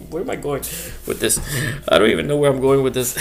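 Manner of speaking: cracking up at his own tangent; laughing. Real, not acted. Host laughing at his own rambling, laughing.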